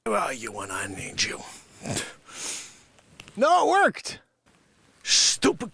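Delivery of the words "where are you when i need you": ordinary talk, nothing marked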